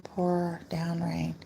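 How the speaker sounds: hushed, slow whispered words; a slow reverent whisper. Real, not acted. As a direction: breathe it out slowly, almost silent. Reading a prayer request softly.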